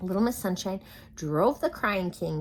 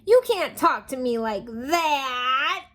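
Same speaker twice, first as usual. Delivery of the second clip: higher, loud, slow sob; sobbing protest; 7 semitones higher, 9 dB louder, slower than usual. Acted. King sobbing at Sunshine's bossy tone. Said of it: "sobbed the king".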